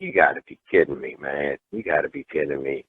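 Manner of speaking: quiet low-voiced retold disbelief; muttered disbelief. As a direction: mutter it to yourself, stunned. Disbelief at being followed.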